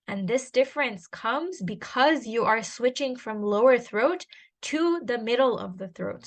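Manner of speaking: ordinary talk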